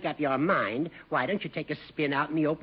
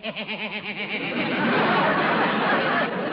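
loud, long chuckling laugh; hearty laugh; 4 semitones higher, 5 dB louder than usual. Acted. Judge laughing at his own joke about Gildy.

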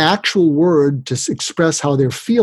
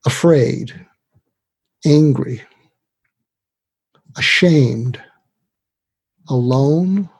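slow word list, long silence after each; 2 semitones lower, slower than usual. Retold or imitated. Psychiatrist offering students words to try on, listing them slowly. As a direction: lay each word out singly, unhurried, falling away. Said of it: "when you list the words and they try them on".

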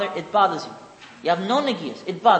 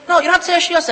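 raised, higher hurried urging; urgent call to child; 8 semitones higher, 7 dB louder, much faster than usual. Retold or imitated. Father hurrying his toddler to the bathroom, raised urgent call. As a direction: hurry the little boy along, half calling.

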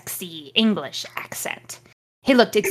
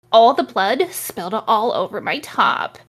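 normal talking voice

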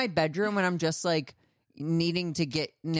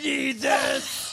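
hammed-up craving at a pitch meeting; mock desperate plea; 4 semitones higher, much slower than usual. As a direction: beg for it slowly like a craving. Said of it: "You're like".

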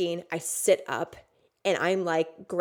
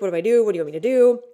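loud, higher urgent questions; re-enacted alarm, much louder; imitated flustered partner; 3 semitones higher, 10 dB louder, much faster than usual. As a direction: voice a partner at a loss.

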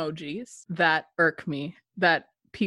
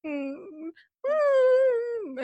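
dog whimper; 14 semitones higher than usual. Retold or imitated. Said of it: "he's like".

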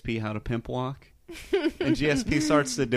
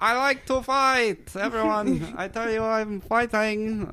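high, slow French Canadian accent, hammed-up; mock French Canadian accent; 10 semitones higher, slower than usual. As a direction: ham up a French Canadian fighter boasting. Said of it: "very French Canadian accent".

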